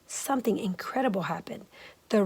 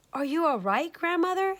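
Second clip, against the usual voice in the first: higher, caring question; higher worried mother's question; concerned asking; 8 semitones higher than usual. Acted. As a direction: check on her gently. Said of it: "asked Mama Chang".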